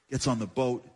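usual speaking voice

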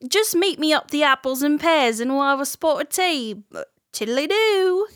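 sing-song mock Cockney toff; Cockney accent. Asked for rhyming slang, jokey Cockney voice. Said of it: "Can you do that?".

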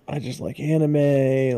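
usual speaking voice

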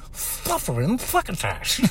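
soft, slow, high-pitched character voice; put-on studio voice. Radio host imitating himself voicing in the studio, odd character voice. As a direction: pitch it up, keep it soft and draw it out. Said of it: "I was in the studio going".

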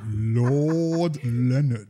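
very slow, drawn-out grand name; pompous title voice. Pretend. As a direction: announce the title with ceremony. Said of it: "you have to sit up and say it".

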